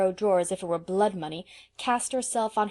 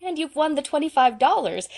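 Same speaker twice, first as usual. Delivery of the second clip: jubilant and higher, louder; 7 semitones higher, 6 dB louder than usual. A narrator's reading. Diana tells Anne she won the prize, jubilant. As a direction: crow the good news. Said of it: "continued Diana jubilantly".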